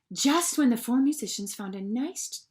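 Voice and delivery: usual speaking voice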